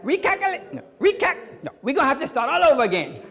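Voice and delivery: high-pitched Cajun stumbling over the word; mock Cajun navigator giving up; hammed-up Cajun accent, voice way up; flustered Cajun GPS. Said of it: "a Cajun voice in that thing".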